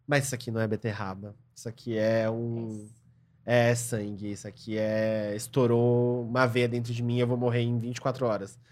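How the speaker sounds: murmured hypochondriac worry, low and soft; hipocondríaco preocupado. Acted. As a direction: voice a hypochondriac's private self-diagnosis, dropping low and quiet. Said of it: "Uma pessoa que acha que tá doente". (hipocondríaco encenado, baixinho e grave)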